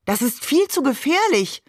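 high-pitched exclamation of protest; calling out. A narrator's reading. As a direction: cry out in alarm, without shouting. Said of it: "rief Maman auch schon". (protestierend ausgerufen)

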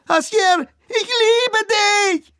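very high, loud desperate cry; shrill scream. A narrator's reading. Girl screaming her love as the door shuts. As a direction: scream it after him through the door. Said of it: "gellender Schrei".

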